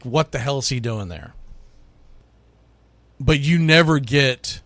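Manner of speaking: ordinary talk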